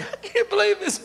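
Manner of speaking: high, tearful mock sob; imitated crying. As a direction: act overcome with grateful tears. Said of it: "He cried".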